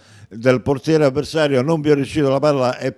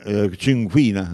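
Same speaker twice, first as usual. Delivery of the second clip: usual speaking voice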